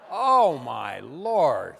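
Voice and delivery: ordinary delivery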